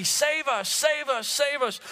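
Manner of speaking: high, lifted repeated plea; mock pious plea. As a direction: sing it up high like a hopeful misreading. Tempting misreading acted out.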